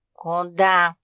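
loud, lazy query. A narrator's reading. British cat asking the pug where to, lazily. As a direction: ask it lazily, in full voice. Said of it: "лениво осведомился британец".

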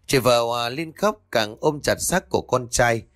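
ordinary delivery, nothing marked

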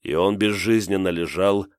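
ordinary delivery

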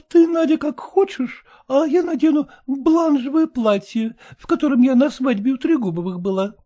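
put-on high, cheeky girl's voice; high mocking daughter; pert young daughter. A narrator's reading. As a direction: tease the mother lightly.

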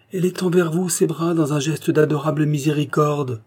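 high, loud proclamation of a vision; exalted outcry. A narrator's reading. Hermit shows both men the Virgin, crying out exalted. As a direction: cry out in rapture, building to a peak. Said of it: "il s'écria".

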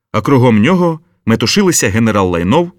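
plain reading voice

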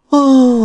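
high, loud, drawn-out "ah". Acted. Woman breaks off her confession with a high sighing ah. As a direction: let out a long, high exclamation.

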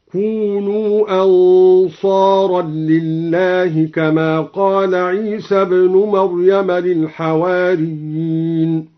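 "أنصارا لله" with nunation and an added lam, slow; Duri reading. Shown as a demonstration. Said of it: "نون الدوري أنصار وزاد لاما". ("أنصارًا لله" بالتنوين واللام)

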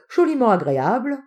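loud, high swoop of bliss; ecstatic. Acted. Uncle delighted by the drink, ecstatic. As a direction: savour it in ecstasy. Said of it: "soupira-t-il en extase".

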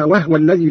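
وهو said wahwa, no vowel on ه; still ha. Shown as a demonstration. Teacher giving another such verse, وهو as wahwa. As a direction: close onto the ه in وهو, wah-wa. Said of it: "وكذلك قوله تعالى".

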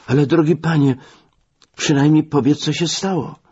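high-pitched plea to a friend; urgent pleading. Acted. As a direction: plead with him, voice pitched well up. Narrator pleads with sick friend. (naglące, błagalne prośby wysokim głosem)

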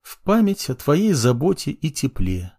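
usual speaking voice

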